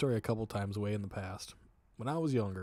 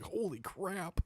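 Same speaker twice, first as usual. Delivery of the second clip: a lightly played holy crap of shock; retold amazement; 9 semitones higher, slower than usual. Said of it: "I was like".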